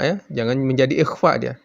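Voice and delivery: ordinary talk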